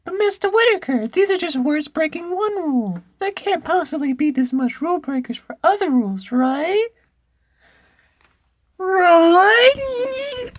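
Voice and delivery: very high squeaky schoolboy; loud, high-pitched boy character. Acted. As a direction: protest to a teacher in piercing falsetto. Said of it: "I gotta do a boy voice".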